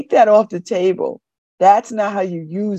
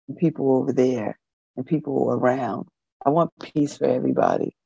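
blissful calm; 8 semitones lower, 4 dB quieter than usual. Pretend. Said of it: "And it's just like".

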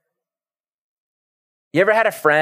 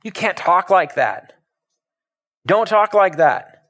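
raised-pitch scolding, each phrase drawn out; rebuking; 5 semitones higher, slower than usual. Acted. Peter correcting Jesus to his face, rebuking. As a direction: scold him slowly and insistently. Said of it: "it says he's rebuking him".

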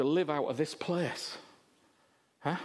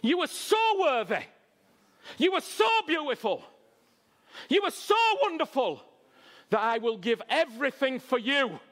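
rising, louder, pleading tenderness; tender Jesus voice; 6 semitones higher, 3 dB louder than usual. Retold or imitated. Preacher voicing Jesus telling the crowd they're worth everything, rising and tender. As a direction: voice Jesus lovingly telling them their worth. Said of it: "Jesus looked at you and he went".

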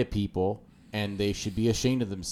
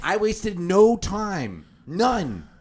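slow emphatic; 8 semitones higher, 3 dB louder, much slower than usual. Real, not acted.

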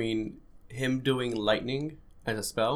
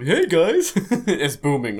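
booming voice; 5 semitones higher, 7 dB louder, much slower than usual. Pretend. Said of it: "A big booming voice".

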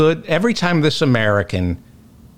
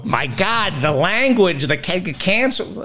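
higher, hammed-up pearl-clutching gasp; mock outrage; 6 semitones higher, slower than usual. Host mocking the outraged reaction he didn't have, hammed-up. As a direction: play a shocked scold, tongue in cheek. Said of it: "I didn't go".

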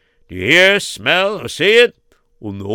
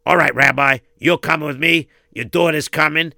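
ordinary delivery

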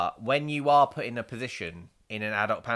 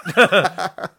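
big burst of laughter; loud laugh; 8 semitones higher, 10 dB louder than usual. Real, not acted.

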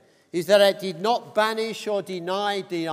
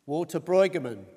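ordinary talk, nothing marked

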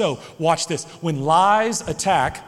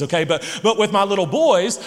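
ordinary talk

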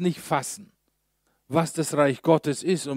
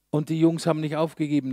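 ordinary delivery

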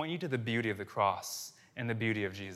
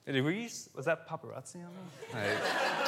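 amused, laughing; 3 semitones higher, 5 dB quieter than usual. Real, not acted. Amused by someone photographing him mid-sermon.

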